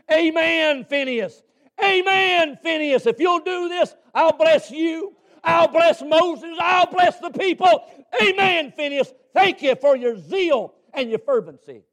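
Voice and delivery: high, shouted cry of approval; preacher voicing God. The Lord cheering Phinehas for his zeal, shouted preaching. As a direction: hurl each blessing out at full pitch. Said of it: "do you know what the Lord was saying".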